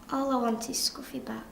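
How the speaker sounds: hushed, nearly whispered wish; small, subdued plea; sad, quiet longing. Acted. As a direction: let it drop soft, missing the dog. Child grieving the lost dog.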